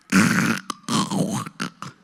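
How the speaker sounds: loud rasping snore noise; husband already asleep, hammed-up snore; mock snore. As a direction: imitate a husband snoring.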